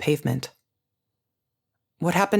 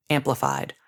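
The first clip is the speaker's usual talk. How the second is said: ordinary delivery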